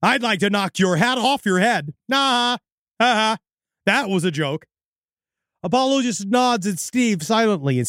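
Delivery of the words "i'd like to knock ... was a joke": unnatural joker with a high, separated fake laugh; weird stilted stranger. Odd candidate joking at a chip stocker, stilted. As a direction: deliver the joke as if humor is new. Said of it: "in a weird accent".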